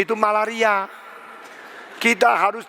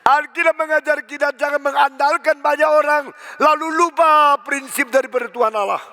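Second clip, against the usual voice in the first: ordinary talk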